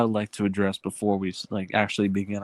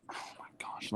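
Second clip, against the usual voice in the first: whisper; 19 dB quieter than usual. Real, not acted. Exasperated aside to Landon.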